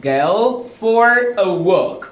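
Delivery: "go for a walk" stretched out slowly; slow model phrase. Shown as a demonstration. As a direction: drill the phrase slowly for learners. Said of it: "et non go". ("go for a walk", lentement, bien détaché)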